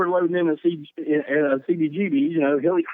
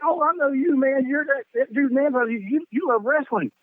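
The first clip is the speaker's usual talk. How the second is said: Dave's friendly greeting; 9 semitones higher than usual. Retold or imitated. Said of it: "he's like".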